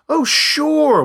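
roaring. A narrator's reading. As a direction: bellow it out mockingly.

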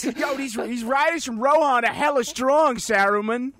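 loud, high Boston goblin; hammed-up Boston accent, shouted; Bostonian goblin. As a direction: holler a report in mock Boston. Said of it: "is one Bostonian goblin".

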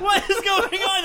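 loud and high, laughing through the words; laughing disbelief. Real, not acted.

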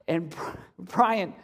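usual speaking voice

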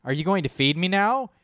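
put-on the baby notices someone at last. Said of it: "He was like".

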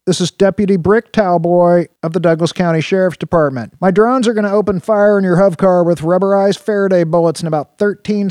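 loud, high, commanding lawman over a radio; authoritative deputy. A narrator's reading. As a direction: bark orders through the comm. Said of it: "authority-drenched male voice now began speaking through the Mustang's comm".

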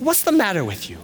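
high mock scolding, falling away; berating dad. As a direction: imitate an exasperated father. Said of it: "They're berating me".

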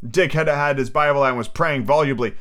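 usual speaking voice

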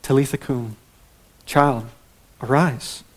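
put-on soft, tender waking of a child; gentle, affectionate. Shown as a demonstration. Jesus wakes the dead girl, softly tender. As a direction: coax the words out like waking a napping daughter. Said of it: "Just so gentle".

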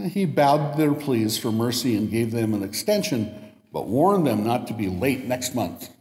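normal talking voice

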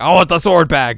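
loud petulant demand. Retold or imitated. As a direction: insist on getting it back. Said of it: "And he's like".

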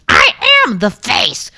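very high squeaky shout; Lexi. Acted. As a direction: insist in a loud squeaky voice. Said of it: "Hi, I'm Lexi".

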